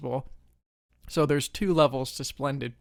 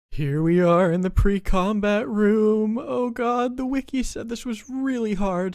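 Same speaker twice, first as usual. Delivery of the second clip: higher-pitched, anxious build-up; nervous dread; 7 semitones higher, 6 dB louder than usual. Acted. Player nervously entering the pre-combat room, anxious. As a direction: voice rising with dread at what's coming.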